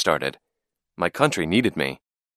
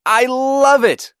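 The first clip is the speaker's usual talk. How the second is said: very high, loud, stretched-out cheer; excited yell; 6 dB louder, slower than usual. A narrator's reading. Boy thrilled by his plane ride, yelling. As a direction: whoop it out over the engines. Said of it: "I yelled".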